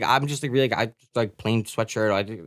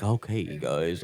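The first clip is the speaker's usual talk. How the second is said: low, slow, soft radio voice; late night radio host; 12 semitones lower, 8 dB quieter, slower than usual. Retold or imitated. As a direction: ease in like a 3am DJ. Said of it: "a late night radio host".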